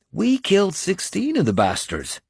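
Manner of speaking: pleased with the battle's tally; happily. A narrator's reading. Finan reporting the dead to his lord, happily. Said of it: "he said happily".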